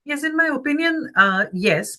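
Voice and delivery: ordinary talk, nothing marked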